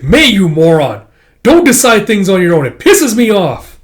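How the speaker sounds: acted furious telling-off; angry shout. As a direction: yell it in exasperation. Warrior angrily scolds another.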